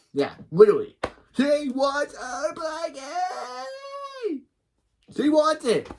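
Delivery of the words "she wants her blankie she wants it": high, stretched mock wail; tantrum wail. Narrator echoing Khloe's demand, high tantrum wail. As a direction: squeal it out, dragging the last word.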